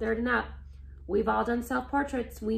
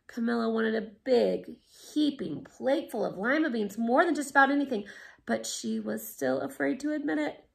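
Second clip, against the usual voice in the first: ordinary delivery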